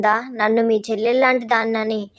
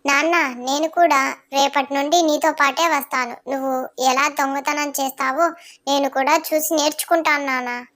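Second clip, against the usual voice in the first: put-on child voice; 3 semitones higher than usual. Son wants to join father.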